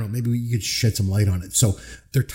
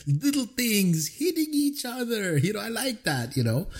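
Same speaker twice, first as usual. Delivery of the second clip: Napoleon impression; 8 semitones higher, 3 dB quieter than usual. Napoleon quoted delighted, high voice.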